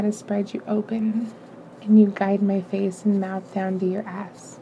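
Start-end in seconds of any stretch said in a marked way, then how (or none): none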